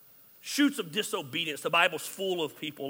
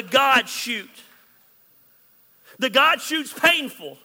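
slow, loud, weighty preacher voice; full-force preaching; 4 semitones higher, 7 dB louder, slower than usual. Acted. Pastor pressing the point on his congregation, slow and loud. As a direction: drive it home like a pulpit climax.

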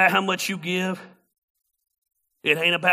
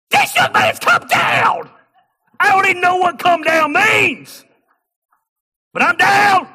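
shouting preacher; 12 semitones higher, 12 dB louder than usual. Retold or imitated. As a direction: belt it out like a preacher celebrating a convert. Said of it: "He's like".

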